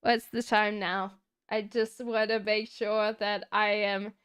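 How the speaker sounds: ordinary talk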